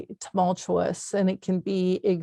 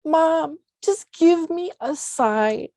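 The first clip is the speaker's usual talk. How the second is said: high, halting tearful plea; choked up; 8 semitones higher than usual. Real, not acted. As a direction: voice lifted and straining with real emotion. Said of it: "get choked up saying this".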